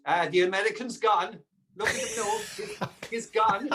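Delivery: lightly voiced Irish neighbour, mock pitying; a hint of an Irish farmer tutting; Irish villager. Irish locals teasing the emigrant, light mock Irish lilt. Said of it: "as soon as you've stepped onto the boat".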